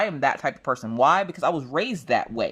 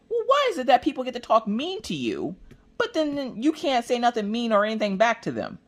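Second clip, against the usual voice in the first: indignant son; 10 semitones higher than usual. Retold or imitated. Son arguing back with mom.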